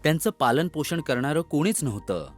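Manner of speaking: ordinary delivery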